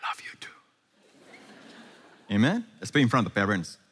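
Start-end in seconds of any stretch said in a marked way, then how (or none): 0.1-2.3 whispered lover's reply; hushed, intimate whisper back. Acted. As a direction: answer very softly, without voice.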